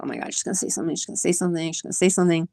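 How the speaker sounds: hushed, worried muttering to oneself; retold nervous thought, kept very soft; anxious inner voice. Woman dreading a stranger will speak to her, anxious and hushed.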